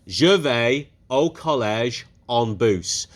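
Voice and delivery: "Je vais au collège en bus" said slowly, word by word; slowly modelled French. Shown as a demonstration. Teacher models the full sentence slowly to pupils. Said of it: "I would just go en bus".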